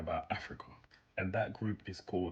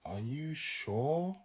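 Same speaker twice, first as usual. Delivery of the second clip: very slow, higher, rising doubt; slow, deliberate sarcastic question; drawn-out sarcasm; 7 semitones higher, much slower than usual. Real, not acted. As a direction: stretch every word out skeptically.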